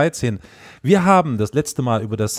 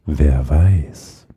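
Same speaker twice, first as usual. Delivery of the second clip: deep, mock-seductive teasing; low teasing; 8 semitones lower, 4 dB louder than usual. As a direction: drop the voice low and tease. Repeated teasing, deeper. (tief und verführerisch neckend)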